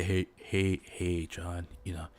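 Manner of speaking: sweet-talking the manager John in a low hush. Pretend. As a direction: whisper a smooth pitch to the boss. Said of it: "I sweet talk my way in".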